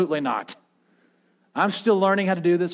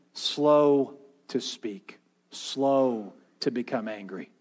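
low, deliberate, stretched-out delivery; slow and measured; 7 semitones lower, 5 dB quieter, slower than usual. Real, not acted. Pastor urging the church to be slow to anger, slow and measured. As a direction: draw out "slow", then let it settle softly.

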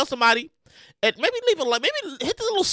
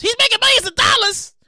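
ordinary talk, nothing marked

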